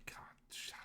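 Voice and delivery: breathy unvoiced whisper under the breath. Real, not acted. Streamer overwhelmed by the sad scene, whispering. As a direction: hush it out, overwhelmed.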